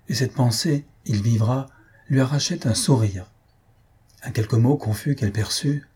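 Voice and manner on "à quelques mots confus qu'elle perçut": plain reading voice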